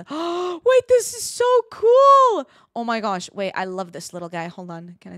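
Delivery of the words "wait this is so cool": high, loud, drawn-out squeal of delight; gasp, then gush loudly in amazement; awed excitement. Real, not acted. Delighted by the game.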